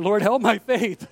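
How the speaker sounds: lightly hammed-up prayer for help; mock desperate plea. Preacher acting out his storm prayer, mock desperate.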